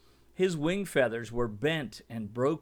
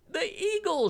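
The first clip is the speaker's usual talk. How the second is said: high startled cry of alarm; frightened cry. A narrator's reading. Mimby crying out in fear at the eagle's shadow. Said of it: "she cried".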